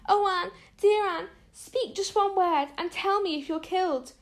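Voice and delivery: high, frightened pleading; frantic plea. A narrator's reading. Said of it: "shrieked Diana".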